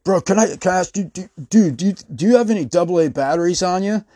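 lightly voiced twitchy dude asking a favor; hesitant tweaker ask. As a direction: fumble through the question like a jittery tweaker. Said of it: "The guy goes".

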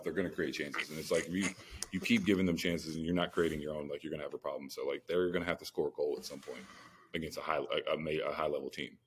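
ordinary delivery

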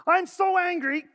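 high, loud pretend rage; hammed-up angry yell; mock anger. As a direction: shout it like a staged tantrum. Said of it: "is this what you do when you get angry".

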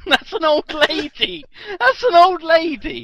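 laughing shock. Real, not acted.

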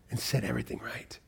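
soft whisper. Acted. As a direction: let it fade to a whisper. Hushed close of the promise.

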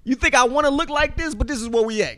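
high loud exasperated rant; exasperated shout. Retold or imitated. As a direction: yell it back, fed up. Ranting back at the woman.

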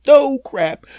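high, loud, triumphant shout of no crap; sudden high yelp of relief; relieved shout. Retold or imitated. Comic refrain checking for mess.

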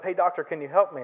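usual speaking voice

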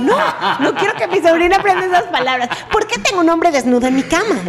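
mock-shocked self. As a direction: squeal it in mock horror. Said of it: "Y yo".

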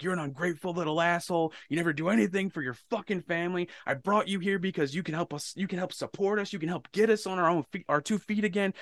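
high-pitched retold angry tirade; imitating father snapping. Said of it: "he snapped on me".

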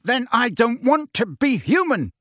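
high-pitched angry outburst; roaring. A narrator's reading. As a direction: hurl it out in anguish. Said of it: "Harry roared".

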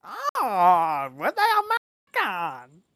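loud, camp mock German accent.

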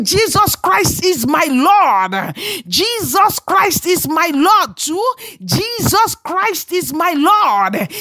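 high, slow chant-like proclamation; preach. Real, not acted. Preacher leading the congregation seven times, high drawn-out proclamation. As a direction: ring it out, stretching each word. Leads repeated confession at full force.

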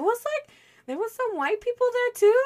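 squeaky high teasing tone; high-pitched voice. Acted. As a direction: pitch way up for the joke. Playful high-voiced aside.

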